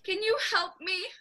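mock sobbing. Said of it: "began to cry as she sobbed".

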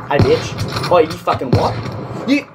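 loud, high furious yell at the enemy; angry yell. Real, not acted. Gamer yelling at the enemy who killed him, furious. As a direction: yell at the player who shot you.

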